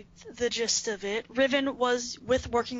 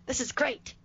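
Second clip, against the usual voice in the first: gleeful. Retold or imitated. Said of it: "you know it's bad when Riven isn't like".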